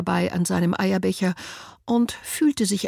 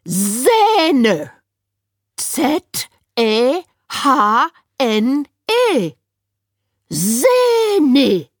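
high, loud, slow spelled-out letters; loud high patronizing spelling; Winnie spelling; 6 semitones higher, 6 dB louder, much slower than usual. A narrator's reading. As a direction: spell it out like to a child.

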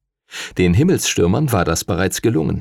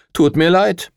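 mean grin; 8 semitones higher than usual. Acted. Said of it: "mit einem gemeinen Grinsen".

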